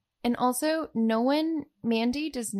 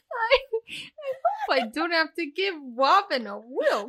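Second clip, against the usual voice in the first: laughing through the words, higher and slower; 7 semitones higher, 5 dB louder than usual. Real, not acted. Cohost giggles while riffing on the kiss scene, laughing. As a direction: barely get it out through giggles.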